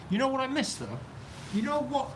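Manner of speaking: a high pitched, eager "you know what", voice jumping up; sudden excited question. Real, not acted. To viewers, suddenly excited about what he misses. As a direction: caught by a sudden thought. Wistful thought hits while streaming.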